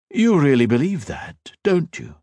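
amused emperor. A narrator's reading. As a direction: humor the fool. Villain voiced.

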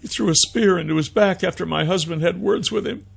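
high woman's voice, distressed; high-pitched, haltingly paused grief; grieving young mother. A narrator's reading. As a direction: tell it as a grieving widow. Mother tells of husband's killing.

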